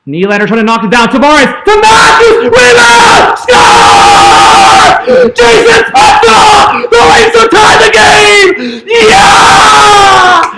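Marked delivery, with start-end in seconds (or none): 1.1-10.5 screaming high and loud as the tying goal goes in; ecstatic goal yell. Real, not acted. Fan roaring at the screen for the tying goal, ecstatic yell. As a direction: let it rip, full-throated goal call.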